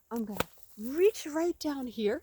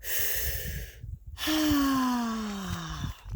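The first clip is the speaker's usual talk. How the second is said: long sigh. Acted. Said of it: "deep breath of fresh morning air".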